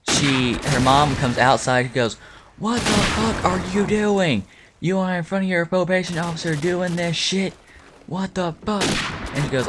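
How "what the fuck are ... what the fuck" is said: high-pitched mock scolding; imitating angry mom. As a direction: put on an outraged mother's high voice. Angry mom scolds daughter in retelling.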